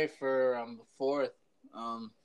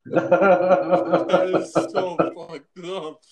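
laughing; 4 semitones higher, 9 dB louder, much slower than usual. Real, not acted. Laughing at dark joke.

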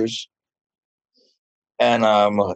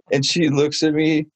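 normal talking voice

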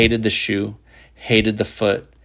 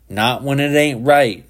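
defiant retort; 5 semitones higher, 4 dB louder than usual. A narrator's reading. Boy defiantly contradicts Shepard.

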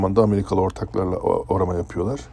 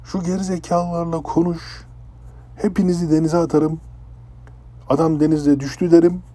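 furious threat; 6 semitones higher than usual. Retold or imitated. Captain threatening Kaan's crew on the bridge, furious rant. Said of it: "adam bunu çok ciddi söyledi".

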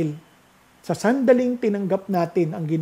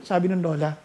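ordinary delivery

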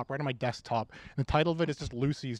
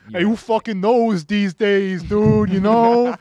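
put-on Boston accent; 5 semitones higher, 10 dB louder, much slower than usual. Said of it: "sexist Bostonian right now".

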